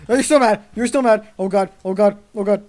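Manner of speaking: loud, high panicked outburst. Real, not acted. Player attacked by angry cows, loud panic. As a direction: yelp it in rising alarm.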